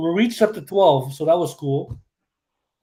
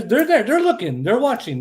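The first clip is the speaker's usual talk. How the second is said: higher, louder, playful warning; mock spooky excitement; 6 semitones higher, 3 dB louder than usual. Real, not acted. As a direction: hype up the spying wrestlers. Joking about spying wrestlers.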